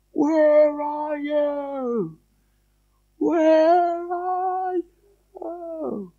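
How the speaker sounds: high, soft, long-drawn falsetto; thin, stretched-out faraway cry; very faint distant call. Acted. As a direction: call from far over the water. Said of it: "very faintly".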